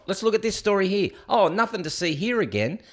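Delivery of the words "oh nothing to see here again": ordinary talk, nothing marked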